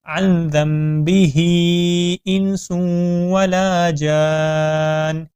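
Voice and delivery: ذَنْبِهِ with the nun merged into a small meem sound; joined recitation. Shown as a demonstration. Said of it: "ذال کو ہم ملائیں گے جو چھوٹی میم ہے".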